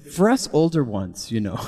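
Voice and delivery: put-on British accent. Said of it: "his British accent".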